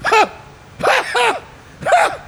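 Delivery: high mock cackle of a laughing sergeant; imitated guffaw. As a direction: burst out in a squeaky imitated laugh. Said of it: "you see the sergeant go".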